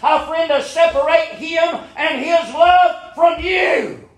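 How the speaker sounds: high, rising preacher's call building to a shout; full-force sermon climax; fiery preaching. Acted. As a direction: preach it hard to the congregation.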